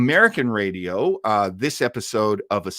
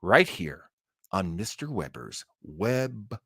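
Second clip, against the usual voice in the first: slow, drawn-out spooky show intro; dramatic host intro, trailing off into a whisper; show announcer; slower than usual. Acted. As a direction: announce it like an old radio host.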